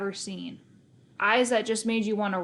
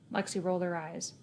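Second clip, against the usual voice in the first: ordinary delivery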